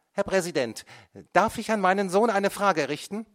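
high, loud, pressing plea to the judge; a mother pushing forward in court; mother's plea. A narrator's reading. Mother asks the judge, loud and high.

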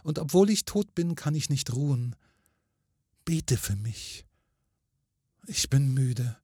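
mild sorrowful entreaty; weary pleading ghost. A narrator's reading. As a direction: plead as a restless, tired spirit. Pleading ghost woman of the story.

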